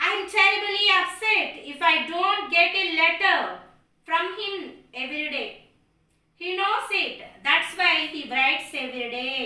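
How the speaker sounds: put-on loud, emphatic old mother. A narrator's reading. Old mother boasting to her daughter about her son, loud. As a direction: insist it proudly, loud.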